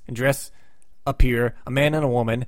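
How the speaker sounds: normal talking voice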